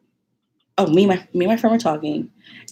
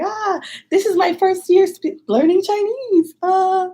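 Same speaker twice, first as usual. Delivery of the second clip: much higher, slow, nervous beginner's speech voice; imitating her nervous self; 14 semitones higher, 3 dB louder, slower than usual. To the contest audience, a nervous high-pitched learner's speech. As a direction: squeak it out like a shy first-year student. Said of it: "two minutes of me being like".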